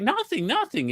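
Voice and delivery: high, dismissive nothing nothing; mock wife brushing it off. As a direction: play the wife waving it away. Voicing wife's evasive reply.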